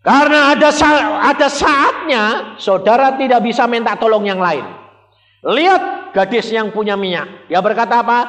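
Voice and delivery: full-force preaching. Acted. Preacher proclaiming to the congregation, high and loud. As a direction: proclaim it from the pulpit.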